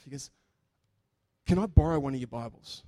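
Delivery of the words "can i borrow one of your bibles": emphatic. Retold or imitated. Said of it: "the way he did it was very emphatic".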